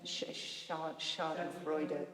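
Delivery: Schadenfreude said slowly and softly, starting with an unvoiced Sch-; German word. Shown as a demonstration. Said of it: "What's the German?".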